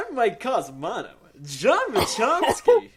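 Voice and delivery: high-pitched, loud mock host intro; Mike Casamano. Host showing his own impression of a co-host, hammed-up. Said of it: "Let me do mine".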